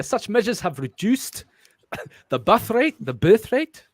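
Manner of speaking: put-on South African accent. Reader testing words aloud, South African accent. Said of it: "work on my South African accent".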